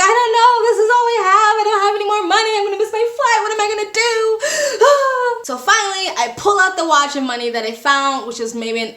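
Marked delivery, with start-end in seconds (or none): 0.0-4.4 high, fast, loud sing-song mock wailing; fake despair. Traveler faking tears to the bribing official, hammed-up wailing. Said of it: "So I put on a whole performance". 4.4-5.4 mock crying sigh. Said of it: "I put on a whole performance".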